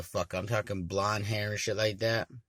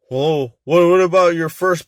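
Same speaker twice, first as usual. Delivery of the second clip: pushy demand; 7 semitones higher, 14 dB louder than usual. Retold or imitated. Stranger pushes for firstborn.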